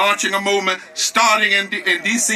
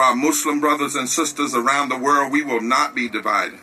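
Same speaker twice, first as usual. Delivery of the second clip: lower, hushed preacher voice; solemn preaching; 5 semitones lower than usual. Real, not acted. Preacher leads moment of prayer.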